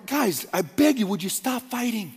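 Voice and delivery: a hint of a weary mother begging; tired, desperate plea. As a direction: beg them to stop, exhausted. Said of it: "fatigued and a little bit desperate".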